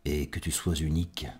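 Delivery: hushed, halting poetry reading; quiet, tender love-poem recital; intimate poem recitation. Acted. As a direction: let the words sink toward a whisper between long pauses.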